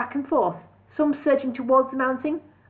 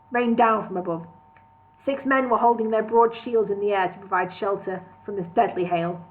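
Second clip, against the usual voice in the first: usual speaking voice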